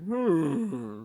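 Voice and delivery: long, loud, high-pitched "hmm"; hammed-up cartoon hum of displeasure; cartoon groan. Shown as a demonstration. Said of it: "but it's like".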